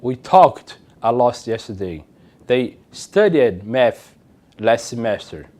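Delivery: slow, careful English; slow model sentences. Shown as a demonstration. Teacher reads affirmative examples slowly to class. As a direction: read them slowly for learners. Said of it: "o verbo no passado simples".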